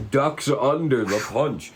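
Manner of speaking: ordinary talk, nothing marked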